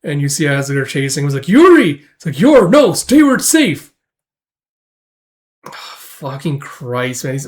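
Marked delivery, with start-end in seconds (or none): 1.5-2.0 high, loud cry of a name; alarmed call. Retold or imitated. Sister calling to her chased brother, alarmed call. 2.4-3.9 high, loud acted plea; urgent warning call. Chased brother calling to his sister, urgent warning.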